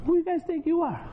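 high, fast mock indignant woman; sassy woman voice. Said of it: "She goes".

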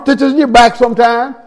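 ordinary delivery

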